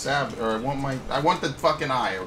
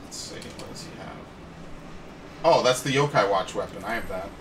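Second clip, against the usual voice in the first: ordinary delivery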